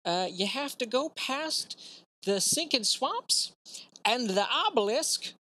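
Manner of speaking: put-on high Southern old man; folksy Southern old-timer rambling; Southern accent. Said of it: "Why is everyone Southern?".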